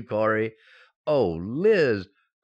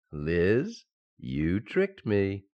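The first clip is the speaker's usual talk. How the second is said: tender mock complaint; 7 semitones lower, much slower than usual. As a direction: grumble fondly after the kiss. Husband after the kiss.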